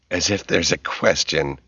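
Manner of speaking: playfully indignant, understated; fake indignation. A narrator's reading. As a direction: mildly feign offence. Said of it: "said with fake indignation".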